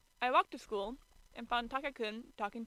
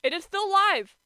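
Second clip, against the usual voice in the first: higher, loud surprised exclaim; surprised shout; 9 semitones higher, 13 dB louder than usual. Real, not acted. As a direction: blurt it out in shock. Surprised stream is live.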